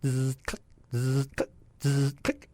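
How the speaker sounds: buzzing zzz then a sharp click, repeated; camera motor-drive noise. Retold or imitated. Retelling the guy's camera on the car, imitated motor-drive clicks. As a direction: mimic a film camera advancing. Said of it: "all I could hear was a click".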